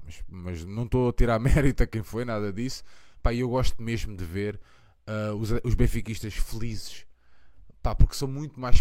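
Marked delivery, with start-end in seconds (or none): none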